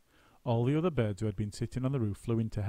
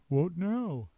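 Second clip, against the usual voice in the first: out of breath; 8 semitones higher than usual. A narrator's reading. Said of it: "He was out of breath".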